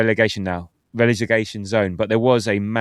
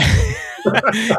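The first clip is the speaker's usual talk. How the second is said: high burst of real laughter; loud laugh; 13 semitones higher, 4 dB louder than usual. Real, not acted. Laughing at the calm message.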